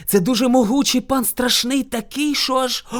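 high-pitched, dramatic dread; awed hare. A narrator's reading. Hare warns bear of mighty rival, fearful awe. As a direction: warn breathlessly of a terrible foe, ending in a gasp.

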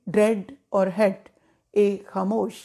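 ordinary delivery

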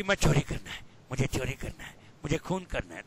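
low, softened thinking-aloud voice; imitated inner thought. Voices a negative thought in the mind. (मन की बात की धीमी नकल)

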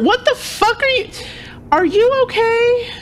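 high, alarmed squeak at something strange; startled shriek. Real, not acted. Streamer startled by a weird turret, shrieking high.

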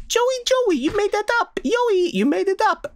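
squeaky parody of people who doubted him; high mocking whine of the naysayers; mocking whiny doubters.

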